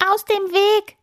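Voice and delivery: high, urgent bird cry; calling out. A narrator's reading. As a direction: call to clear the way. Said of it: "ruft sie". (laut und hoch gerufen)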